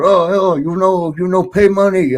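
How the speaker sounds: high mock broken-English host voice; imitating the club host. Imitating the club host demanding his money, high.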